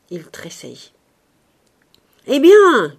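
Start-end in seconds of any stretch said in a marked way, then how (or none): 2.3-2.9 high, very loud cry; shout. A narrator's reading. Ursus startled by Dea waking, shouting. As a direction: burst out with a sudden shout. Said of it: "cria-t-il".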